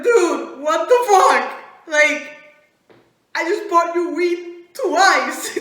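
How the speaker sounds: loud, high indignant yell; mock outrage. Teller confronting a friend, loudly indignant. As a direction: protest at full volume.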